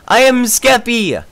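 loud, slow proud intro; Skeppy impression. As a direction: belt it out like a hyped streamer. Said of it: "It's Skeppy".

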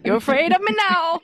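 high, loud mock crying delivery; imitating a tearful hug; imitating Alan's line. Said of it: "I've been saying it over and over".

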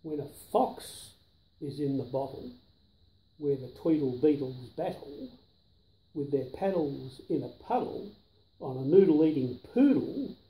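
ordinary delivery

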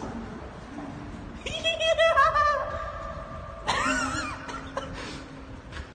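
high, echoing, drawn-out cackle; creepy maniacal laugh. Pretend. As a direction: cackle like a haunting clown ghost. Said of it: "I'm gonna laugh creepy 'cause it sounds cool".

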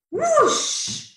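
very high, drawn-out whoosh; whoosh of wind. A narrator's reading. Gust by the pond takes Mole's hat, high whoosh. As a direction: send it swooping up like wind.

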